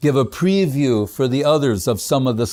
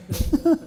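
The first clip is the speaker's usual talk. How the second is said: amused laugh. Real, not acted. Laughs at Mahaprabhu's dodge.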